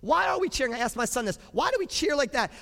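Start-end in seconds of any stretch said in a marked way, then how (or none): none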